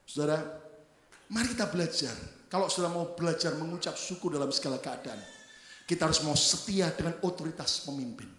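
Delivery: ordinary talk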